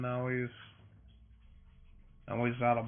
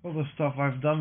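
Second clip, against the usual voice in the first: talking through a yawn; words stretched by a yawn. Real, not acted. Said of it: "Sorry for all the yawning".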